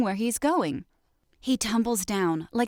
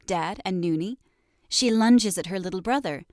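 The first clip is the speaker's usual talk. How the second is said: plain reading voice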